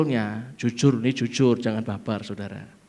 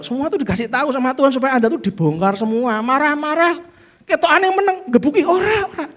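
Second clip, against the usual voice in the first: lively preaching; 11 semitones higher, 4 dB louder than usual. Acted. Preaching at full force.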